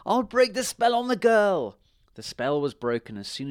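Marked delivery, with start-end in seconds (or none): 0.1-1.8 high, loud surrender; desperate witch cry. A narrator's reading. Witch caught by fire, crying out desperately. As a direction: cry out the promise, pitched way up. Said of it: "she cried".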